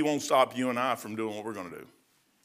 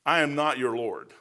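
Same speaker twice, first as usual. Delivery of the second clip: ordinary talk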